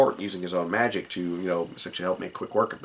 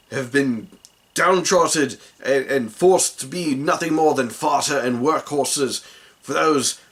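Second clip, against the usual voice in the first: loud, raised rallying cry; rousing revolution speech; 5 semitones higher, 4 dB louder than usual. Acted. Grinwald whips downtrodden goblins into revolt, rousing loud speech. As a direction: rouse the troops to revolt. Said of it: "gives a rousing revolution kind of speech".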